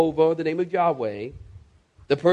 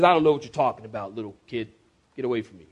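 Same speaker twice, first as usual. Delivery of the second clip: dismissive Peter; 8 semitones lower, much faster than usual. Acted. Peter to the little girl by the fire, dismissive.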